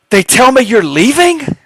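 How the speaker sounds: high, loud disbelieving demand; retold indignant question. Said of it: "She was pissed".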